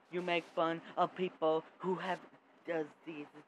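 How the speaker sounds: loud accusing shout; shouted blame; angry accusation. Acted. Accusing angrily.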